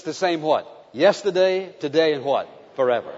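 ordinary talk, nothing marked

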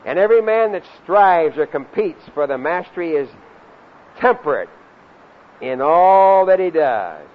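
loud scripture recitation with punched words; forceful preaching. Real, not acted. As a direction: proclaim the verse, leaning hard on key words.

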